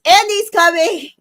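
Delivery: teasing hurried warning; playful alarm call. Retold or imitated. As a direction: sound the alarm as a joke. Serena jokes with Toy Story warning.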